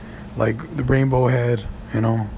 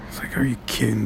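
whispered disbelief; 5 semitones lower, 7 dB quieter, faster than usual. Retold or imitated. Inner reaction to bad movie.

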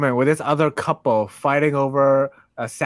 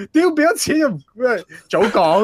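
impatient rude guy; 6 semitones higher than usual. Retold or imitated. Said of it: "the dude is like".

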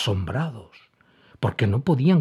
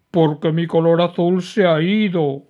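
high, loud whiny complaint; a big dragon sobbing out his loss; crying dragon; 8 semitones higher, 6 dB louder than usual. A narrator's reading. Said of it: "mientras seguía llorando".

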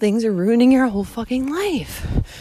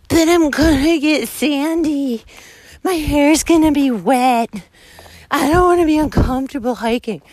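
mock whiny hiker; 5 semitones higher than usual. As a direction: whine it like a fussy complainer. Mimicking hikers' excuses after advice.

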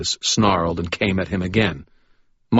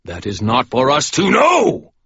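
loud, higher, final; booming shout at the priest; 7 semitones higher, 5 dB louder than usual. Acted. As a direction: cut the argument off hard. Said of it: "Michael shouted".

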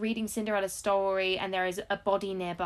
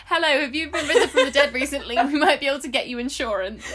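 higher, louder sales-pitch voice with laughter; mock cold-call pitch, laughing; insurance salesperson; 4 semitones higher, 6 dB louder than usual. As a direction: play a pushy insurance caller. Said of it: "Why do you sound like something like".

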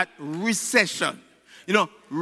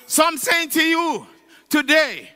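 ordinary talk

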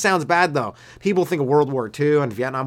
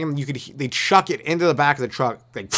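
ordinary talk, nothing marked

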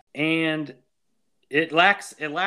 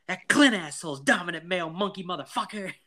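high, worked-up mock rant; Mike; 5 semitones higher than usual. Podcaster imitating Mike before round two with the bully, riled high voice. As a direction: spit out the insult in a raised, put-on voice. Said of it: "That's from Mike whenever he decides".

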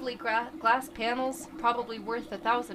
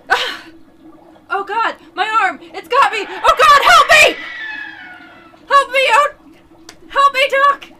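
high, loud screams of pain; agonized screaming; 13 semitones higher, 17 dB louder than usual. Acted. Mike screaming as the crate creature bites, loud and high. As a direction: shriek as if the monster has your arm. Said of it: "Mike's screams are cut short".

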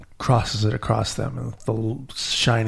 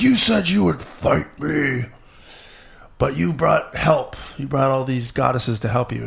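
storyteller acting a furious king, easing toward the end; angry demon king; 3 semitones higher than usual. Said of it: "And he's so mad. He's like".

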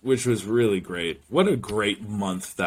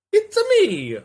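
much higher, cartoon Italian plumber voice; cartoon hero impression; 5 dB louder than usual. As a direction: pop out a squeaky video game catchphrase. Game-hero catchphrase impression.

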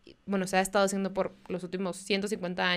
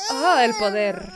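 mock pained gasp; 7 dB louder than usual. Mocking man's pained reaction.

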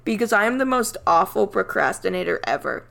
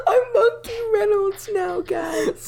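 laughing; 13 semitones higher, slower than usual. Pretend. Laughing, mock persona.

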